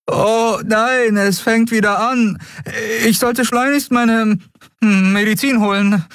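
put-on a scared kid hamming up an allergy; nervous boy faking. A narrator's reading. Miko fakes a cat allergy to the Skria, high and hammed-up.

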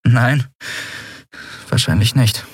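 ordinary delivery

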